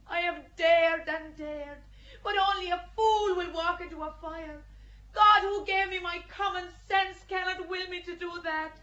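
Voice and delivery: rising, loud, anguished protest; impassioned outcry. Acted. Joan recanting before the judges, impassioned shout. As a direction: plead it out loud against the fire.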